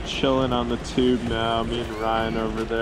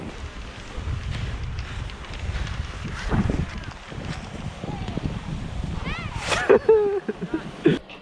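breathy laughter; 4 dB quieter than usual. Real, not acted. After the fast run.

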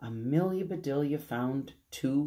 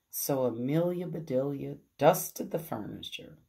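plain reading voice

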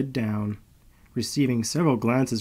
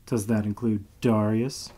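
usual speaking voice

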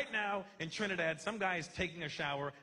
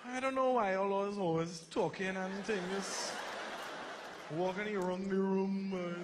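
slow sing-song Trinidadian lilt; Trinidadian accent; slower than usual. Retold or imitated. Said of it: "that sing-songy accent".

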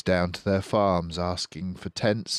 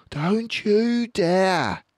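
high, louder, slow warning; stern mother fox; 14 semitones higher, 5 dB louder, much slower than usual. Acted. Missus Fox to her cub, slow stern warning. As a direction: warn the child, slowly.

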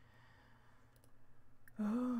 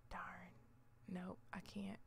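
whisper; 5 semitones lower, 11 dB quieter than usual. Real, not acted. Whispered to herself.

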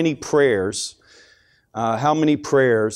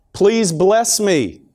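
acted plea, high and slow; crying out to God; 6 semitones higher, much slower than usual. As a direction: draw out an imploring prayer, pitched up. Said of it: "a mother cried out to the Lord".

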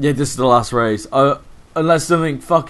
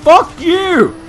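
high, loud curse at the screen; real rage shout; angry shout; 6 dB louder than usual. Real, not acted. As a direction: spit it out furiously. Yelling at opponents.

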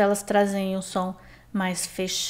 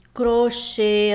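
crochê, louder, closed ê at the end; stressed final syllable; 2 semitones higher, 5 dB louder than usual. Shown as a demonstration. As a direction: land firmly on the last syllable of crochê. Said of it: "as últimas sílabas tônicas". (crochê com a última sílaba tônica e fechada)

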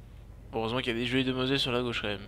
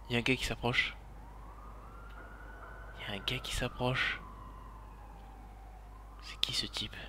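soft, watchful murmur, growing quieter; hushed wariness; 9 dB quieter than usual. Real, not acted. Caché, guette un homme approcher. (chuchoter presque, aux aguets)

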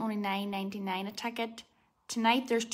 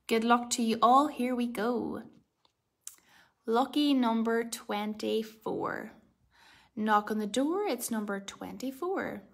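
ordinary talk, nothing marked

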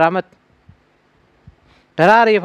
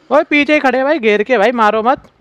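high, loud alarm; panicked pleading; 8 semitones higher, 5 dB louder than usual. Real, not acted. Player surrounded from behind, high panicked pleading. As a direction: cry out cornered, begging for mercy. Surrounded, begs not to be killed.

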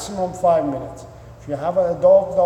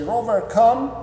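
called out higher to a dog, a firm recall; calling the dog; 6 semitones higher than usual. Shown as a demonstration. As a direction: call the dog to you. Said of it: "and you say".

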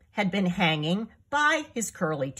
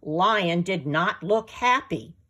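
ordinary delivery, nothing marked

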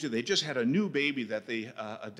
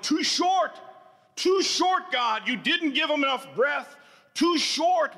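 high, loud cry of grief to God; anguished cry; 8 dB louder than usual. Acted. Preacher crying out to God over a pastor's death, anguished and loud. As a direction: wail it up at heaven.

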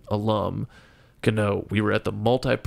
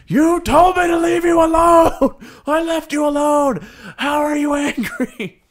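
high, loud yell mixed with laughs; loud, high, half-laughing exasperated rant; 8 dB louder than usual. Retold or imitated. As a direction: shout it in laughing disbelief. Retold exasperated yelling at girlfriend.

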